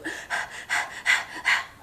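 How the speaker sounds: a long airy puff of breath, copying the bird; soft mock huffing; imitated huff. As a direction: huff and puff like the frustrated parrot. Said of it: "and she'll go".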